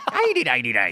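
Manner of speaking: put-on Irish accent. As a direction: ham up a mock Irish lilt. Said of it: "with an Irish accent".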